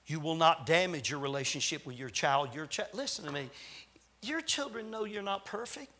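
normal talking voice

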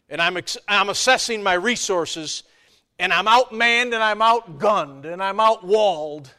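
loud, rising sermon build; preaching at full force. Acted. Preacher to congregation, loud and driving. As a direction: hammer each line home to the congregation.

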